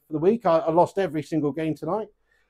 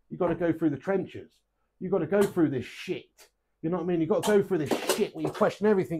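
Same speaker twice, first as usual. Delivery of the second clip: ordinary delivery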